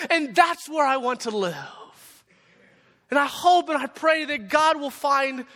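high, loud, fervent sermon climax; preaching at full force. Real, not acted. Preacher urging the church to await Christ's return, full-force preaching.

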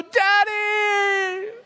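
put-on high, loud, drawn-out child's squeal; excited little daughters. Father imitating his girls greeting him, excited shout. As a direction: shout it like a kid running to a parent. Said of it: "they still run and go".

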